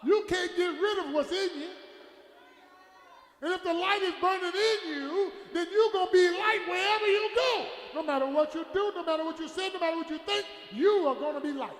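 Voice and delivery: loud, insistent pulpit delivery; emphatic sermon cry; full-force preaching. Real, not acted. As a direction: build it into a preacher's shout.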